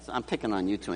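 normal talking voice

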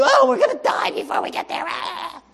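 high, fast mock panic; shrill mock panic shout; whiny complainer. Mocking Israelites again.

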